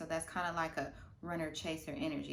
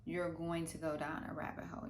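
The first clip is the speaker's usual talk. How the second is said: ordinary talk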